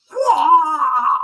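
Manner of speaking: very high, loud kung fu wail; hammed-up nunchuck battle shriek; martial-arts cry. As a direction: let out a long, piercing mock war cry. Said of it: "And I went".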